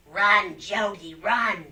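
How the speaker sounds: loud, slow, drawn-out yell calling a name; retold distant yell. Voice actress recalling her sitcom line, a slow shouted warning. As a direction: bellow it like a warning from the woods. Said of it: "they hear this voice yell".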